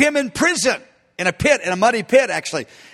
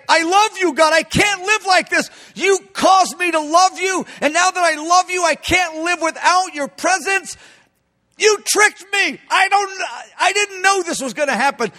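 passionate plea; 8 semitones higher than usual. Acted. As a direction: pour out love desperately, voice climbing high. Jeremiah's passionate cry to God.

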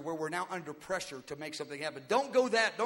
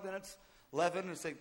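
normal talking voice

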